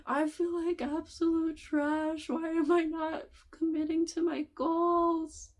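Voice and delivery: hammed-up self-pitying wail; whiny regret. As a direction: whine like a guilty kid. Brain voiced regretting, whining.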